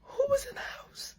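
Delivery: high, very soft, near crying; terrified whimper. Acted. Daughter realizes the truth, high tearful whisper. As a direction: whimper the question in horror.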